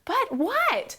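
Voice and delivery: "But what?" pitched high, anxious and bracing. Shown as a demonstration. Voicing the listener who hears the but and expects criticism, anxious. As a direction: brace for bad news. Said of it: "you're waiting for something to tell you".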